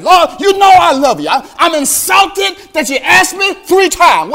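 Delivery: high, loud, offended protest; indignant Peter. Retold or imitated. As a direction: play Peter insulted. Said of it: "I hear you, Peter, saying".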